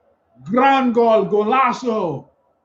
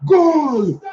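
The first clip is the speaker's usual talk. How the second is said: repeated shouted goal call; long goal yell; 4 semitones higher, 3 dB louder, much slower than usual. Real, not acted. Fan celebrating Hazard's goal.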